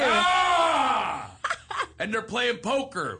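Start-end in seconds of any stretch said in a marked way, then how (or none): 0.0-1.3 loud, drawn-out "ah"; long high yell. Real, not acted. Storyteller breaking out mid-story in a long yell. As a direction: let out one long, high cry.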